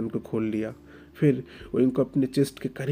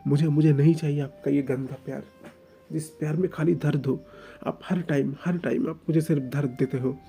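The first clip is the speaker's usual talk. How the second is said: put-on higher, louder hurt young man, stammering; anguished outburst; 4 semitones higher, 5 dB louder than usual. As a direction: pour out the pain in a rising outburst. Oing's outburst about pain. (दर्द भरा फूटता हुआ ग़ुस्सा)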